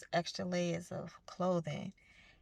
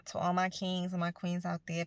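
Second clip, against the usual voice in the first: normal talking voice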